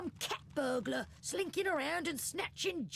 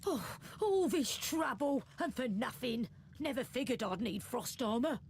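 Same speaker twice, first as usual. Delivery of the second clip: annoyed huff; 7 dB quieter than usual. Acted. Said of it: "She huffs in annoyance".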